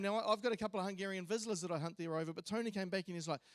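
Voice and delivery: ordinary talk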